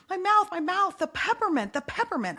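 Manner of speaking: mild mock wail, high at first then falling; retold child's screaming. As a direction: imitate a panicked little boy, toned down. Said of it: "he's screaming".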